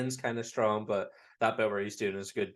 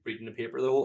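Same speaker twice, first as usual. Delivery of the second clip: ordinary talk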